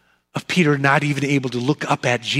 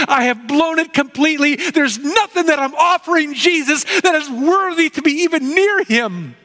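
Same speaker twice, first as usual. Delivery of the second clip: higher, pleading voice of a broken Peter; Peter, remorseful; 9 semitones higher than usual. Acted. Peter confessing his failure after the rooster crows, higher and remorseful. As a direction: let Peter pour out his shame, pitched up.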